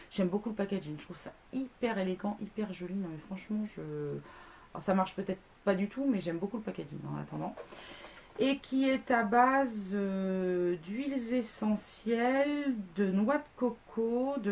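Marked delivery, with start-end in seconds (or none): none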